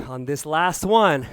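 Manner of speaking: calm cool-down guide. Acted. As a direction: ease them down gently. Leads the cool-down breathing.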